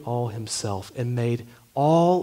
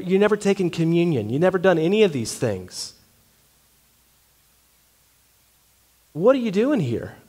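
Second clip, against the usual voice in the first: louder and higher, building in disbelief; exasperated angel; 5 semitones higher, 6 dB louder, faster than usual. Acted. Angel scolding the thief at heaven's gate, exasperated and loud. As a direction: pile it on, rising, fast and indignant.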